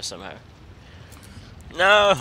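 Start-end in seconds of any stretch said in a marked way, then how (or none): 1.8-2.1 high loud no of fright; frightened cry. Real, not acted. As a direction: cry out in alarm. Sudden scare.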